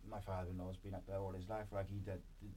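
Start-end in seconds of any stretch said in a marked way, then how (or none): none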